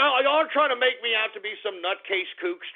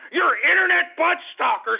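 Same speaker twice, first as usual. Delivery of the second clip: raised, heated accusation; higher, louder indignant jab; exasperated rant; 6 semitones higher, 4 dB louder than usual. Real, not acted. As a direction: jab it at the callers, fed up. Ranting at prank callers.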